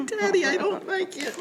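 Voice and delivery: very high little-kid voice, hammed-up; mock childish complaint, on the edge of laughing; whining child. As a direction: whine like a small child pleading with a parent.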